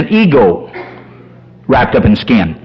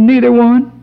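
higher, lightly imitating a crying wife; mock tearful; 7 semitones higher than usual. Crying wife answers her husband, mock tearful. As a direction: answer as if mildly weepy. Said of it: "Honey, why are you crying?".

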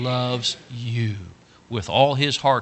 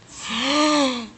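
high, drawn-in gasp; hammed-up gasp of shock; horrified gasp; 13 semitones higher than usual. Little boy hearing he must leave, shocked gasp.

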